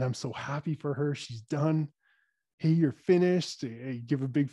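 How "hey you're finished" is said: cheery announcement. Retold or imitated. Proudly tells child she's done.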